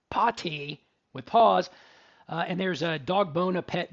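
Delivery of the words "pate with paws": "Pate with paws" very slow and louder; spelled-out pun. Shown as a demonstration. Host explaining a flyer pun, slow emphasis. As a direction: slowly lay out the pun so it lands. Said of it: "You get it?".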